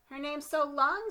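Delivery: high, loud snide schoolgirl; bratty classmate jeering; mocking girl. A narrator's reading. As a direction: sneer at her name. Mean kid teasing in class.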